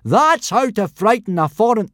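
loud, high, gleeful explanation; bellowing. A narrator's reading. Teacher making his point to the class, bellowing. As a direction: bellow it triumphantly. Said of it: "he bellowed in between great guffaws".

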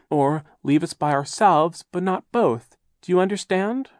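put-on high, pedantic old aunt; prim aunt. A narrator's reading. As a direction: lecture like a fussy schoolteacher. Aunt Josephine lectures grammar.

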